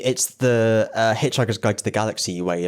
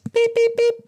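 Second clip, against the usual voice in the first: high beeping; 3 dB louder than usual. Pretend. Imitating a machine's beep.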